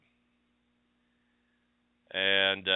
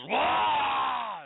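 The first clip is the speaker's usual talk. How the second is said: high, loud mock Hulk roar; hammed-up monster yell; 13 semitones higher, 5 dB louder than usual. Hulk yelling over the fallen hero, a loud mock roar. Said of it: "and then the Hulk goes".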